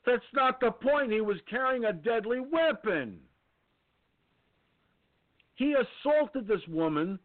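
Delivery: high, heated outrage; venting real fury at the news; angry rant. Real, not acted. Outraged rant about knife attacker.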